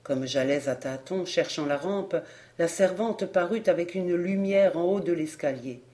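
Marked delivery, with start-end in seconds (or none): none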